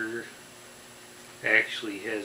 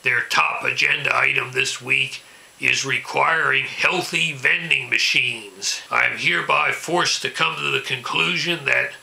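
angry ranting caller; 7 dB louder than usual. Acted. Ranting local political caller impression.

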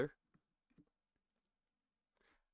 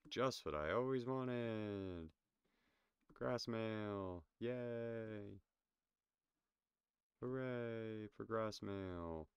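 slow sarcastic fake cheer; drawn-out mock delight over junk; faking delight at gift; 4 semitones higher, much slower than usual.

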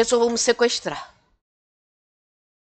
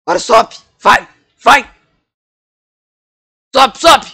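angry shouting; 3 semitones higher, 8 dB louder than usual. Acted. Villain orders at gunpoint.

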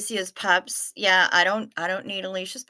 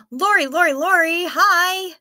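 higher, louder repeated name; thrilled welcome to Lori; excited greeting; 8 semitones higher, 6 dB louder than usual. Real, not acted. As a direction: call her name in a happy burst. Greeting arriving viewer excitedly.

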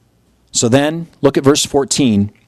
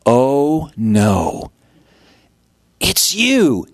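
dawning recognition; much slower than usual. Retold or imitated. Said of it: "they said".

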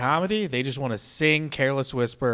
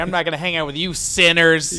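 louder, higher pious putdown; hammed-up holier-than-thou; mock sanctimony; 4 semitones higher, 7 dB louder than usual. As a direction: playfully imitate a righteous scold. Mocks a pious refusal.

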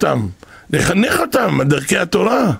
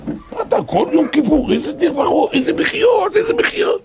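mocking disbelief; 12 semitones higher than usual. People questioning the weeping sage, scoffing and incredulous. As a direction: scoff at all that crying.

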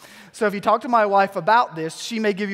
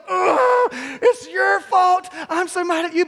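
high, loud mock fury; hammed-up blaming outburst; mock furious wife; 10 semitones higher, 4 dB louder than usual. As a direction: snap it out like an angry wife in labor. Wife in second labor, retold.

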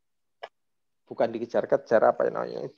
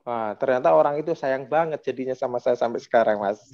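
ordinary talk, nothing marked

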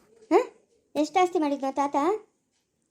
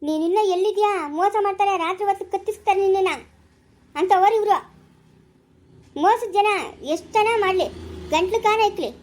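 louder, higher furious tirade; angry shouting; 3 semitones higher, 3 dB louder than usual. Acted. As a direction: rail at him in a rage. Wife yells at husband, quarrel. (ಕೋಪದಿಂದ ಕಿರುಚುವುದು)

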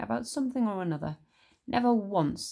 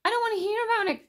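much higher, fast, pleading cry; cried out; 4 dB louder, faster than usual. Acted. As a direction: beg her to stop quickly. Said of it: "cried George".

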